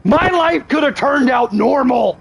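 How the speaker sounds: high, slow, yelled lament; mock despairing shout. Shown as a demonstration. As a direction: wail it out as a hammed-up tragedy. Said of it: "repeats it yelled".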